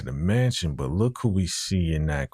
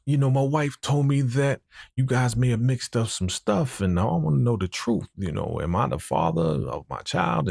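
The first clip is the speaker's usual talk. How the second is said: pleading husband voice; 7 semitones higher than usual. Retold or imitated. Husband confronting the doctor.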